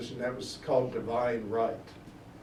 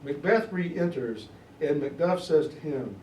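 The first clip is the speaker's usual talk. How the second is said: ordinary talk, nothing marked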